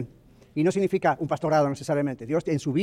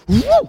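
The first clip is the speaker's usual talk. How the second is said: loud, high "uh!" of free fall; free-fall whoop; 8 dB louder than usual. Pretend. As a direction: let out a whoop like you're dropping from the plane. Free fall of the parachute jump. (grito agudo y fuerte de caída libre)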